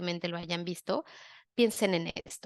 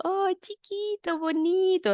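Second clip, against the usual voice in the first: put-on high baby talk; 11 semitones higher than usual. Shown as a demonstration. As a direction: coo it up high like talking to an infant. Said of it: "que les hables desde agudo".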